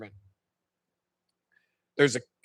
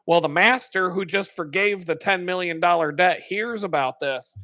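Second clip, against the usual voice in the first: normal talking voice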